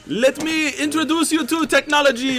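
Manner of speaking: high character voice. Acted. Pliny in character voice.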